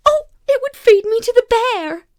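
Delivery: high, loud panic; panicked terror. A narrator's reading. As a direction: blurt out terrified. Child's terrified thought.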